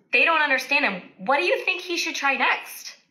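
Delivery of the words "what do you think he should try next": plain reading voice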